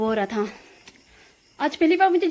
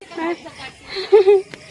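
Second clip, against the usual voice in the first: high, loud burst of laughter; nervous laugh; 6 semitones higher, 5 dB louder than usual. Real, not acted. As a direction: laugh it off after a scare. Just back from running scared.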